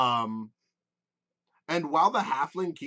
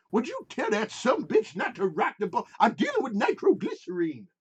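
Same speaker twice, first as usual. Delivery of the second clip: put-on grumpy old man; 7 semitones higher than usual. Pappy grips, grumbling at driver.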